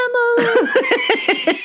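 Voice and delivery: high, long drawn-out name; mock dramatic cry. Co-host echoing the camera moment, mock dramatic. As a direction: stretch the name out, laughing and hammy.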